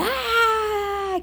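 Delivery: long, high mock scream; imitated scream. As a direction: imitate a drawn-out burning scream without volume. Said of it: "ele começou a gritar". (grito agudo imitado, sem força)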